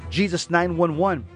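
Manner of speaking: ordinary delivery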